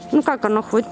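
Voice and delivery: usual speaking voice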